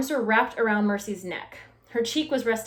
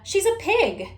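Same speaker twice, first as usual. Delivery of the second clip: shouted; 9 semitones higher, 4 dB louder than usual. A narrator's reading. Eugenia insults the pig.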